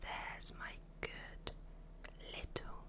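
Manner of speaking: very soft intimate whisper; mummy voice whisper. Acted. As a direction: whisper close in the ear. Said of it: "when I use my mummy voice".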